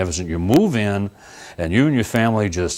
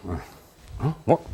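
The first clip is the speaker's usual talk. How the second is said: a quiet baffled mutter from inside the lamp; confused genie; 8 dB quieter than usual. Acted. Genie inside the lamp confused by strokes, soft puzzled mutter. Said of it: "the poor genie's in there and goes".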